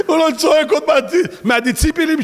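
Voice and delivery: speaking high through laughter; laughing. Real, not acted. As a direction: still laughing at him. Laughing mock of the shouter.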